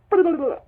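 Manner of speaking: loud, high burst of blah-blah; venting babble. Shown as a demonstration. Speaker shows what they feel like doing, frustrated babble. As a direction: let the frustration spill out as loud gibberish.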